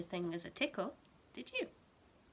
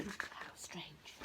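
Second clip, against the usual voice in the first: whispering; 3 semitones lower, 9 dB quieter than usual. Acted. Teacher puzzled, whispered aside.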